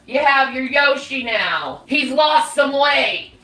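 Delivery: ordinary talk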